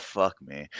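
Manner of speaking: low, very soft retold aside; hushed, low-pitched muttered reaction; under one's breath. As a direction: curse quietly to yourself, imitating the moment. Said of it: "said, like, under my breath".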